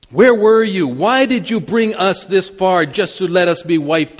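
loud, high, desperate plea to God; anguished crying out. Acted. Joshua face down before the ark, crying out loudly to God. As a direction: cry it out, wounded and pleading. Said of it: "and cried out".

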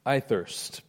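soft and spent; a quiet repeated plea; quiet parched quote. Acted. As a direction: let it fall, drier and wearier. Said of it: "Jesus said".

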